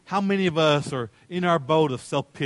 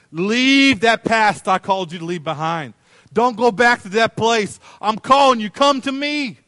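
loud, drawn-out summons climbing higher; urgent calling out; 6 semitones higher, 8 dB louder, slower than usual. Acted. Jesus calls him to leave the past, urgently. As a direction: call them forward with raised voice.

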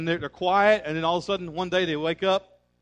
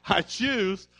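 usual speaking voice

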